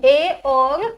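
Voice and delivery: slow, stretched vowel sounds; drawn-out sound demo. Shown as a demonstration. Teacher stretching vowels slowly for her students. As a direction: stretch each vowel out for the class. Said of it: "एक मैंने कौन सी बताई जी".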